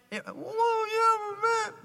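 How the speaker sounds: hammed-up high-pitched little boy answering back; high, put-on "I'm a man" act; mock boy's reply. Acting boy told not to cry.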